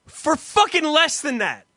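loud, high indignant rant; outraged. Real, not acted. Complaining to his friend about the quote, loud outrage. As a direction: rant in disbelief at the price.

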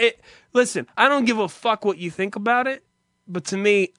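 ordinary delivery

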